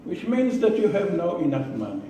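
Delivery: normal talking voice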